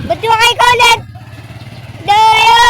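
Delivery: excited shout. Real, not acted. Excited arrival calling out.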